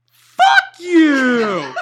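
loud, high drawn-out yell; full-volume mock outburst; real loud shout. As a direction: belt it out slow and loud. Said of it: "like, real loud".

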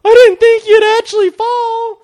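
high, loud, slow taunt; mocking girls. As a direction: imitate a jeering schoolgirl, drawn out. Mocking girls who laughed.

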